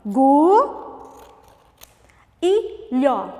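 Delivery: "Gu", long pause, then "lhó"; syllable by syllable. Shown as a demonstration. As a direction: sound out Gu and lhó separately. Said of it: "a quarta sílaba". (Gu e lhó, sílabas bem separadas)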